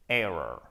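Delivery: "Error" low and grumbly, stress on "Er"; grumbled error. Shown as a demonstration. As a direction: growl "Error" deep in the throat. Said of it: "ça fait un espèce de grommellement".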